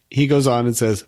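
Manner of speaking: usual speaking voice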